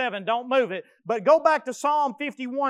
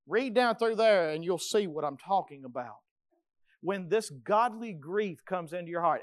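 ordinary talk

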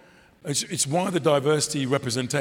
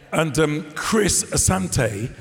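ordinary delivery

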